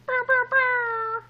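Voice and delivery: high, slow air horn blast with the mouth; hyped vocal air horn; air horn noise. Pretend. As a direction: celebrate the quick math with an air horn. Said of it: "I'm trying to put air horns in here".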